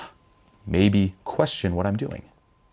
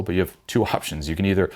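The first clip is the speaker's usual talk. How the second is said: usual speaking voice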